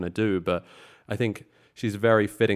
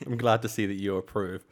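normal talking voice

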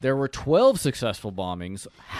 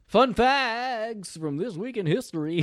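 announcer voice; 10 semitones higher, slower than usual. Acted. Mock segment intro.

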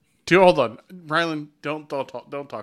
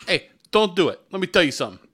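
loud, higher Italian-guy imitation; mock Italian guy; 4 semitones higher, 6 dB louder than usual. Co-host mocking the hotel review, mock Italian guy. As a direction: mimic a pushy Italian guy, loudly. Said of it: "does he turn into an Italian guy".

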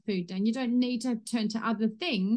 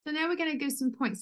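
ordinary delivery

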